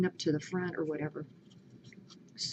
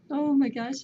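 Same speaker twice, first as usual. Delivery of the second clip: ordinary talk, nothing marked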